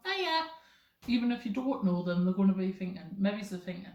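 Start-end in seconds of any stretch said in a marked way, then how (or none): 0.1-0.5 high, casual greeting; friendly hiya. Shown as a demonstration. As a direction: toss out a friendly high-pitched hello. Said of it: "say hi to someone".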